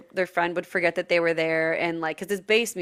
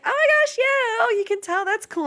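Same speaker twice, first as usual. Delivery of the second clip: very high squeaky cartoon voice; 14 semitones higher than usual. Playing along as Hello Kitty.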